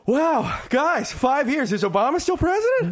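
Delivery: high, wide-eyed mock amazement; hammed-up bewildered traveler; clueless returning passenger. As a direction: play the dazed guy stepping off the plane. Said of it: "going like".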